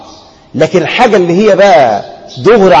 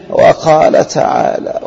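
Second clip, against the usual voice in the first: slow, dragging, half-voiced mock sermon opening; sleepy slow delivery; 3 semitones lower, much slower than usual. Shown as a demonstration. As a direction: drag it out so the crowd would doze off. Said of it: "الناس هتنام منك".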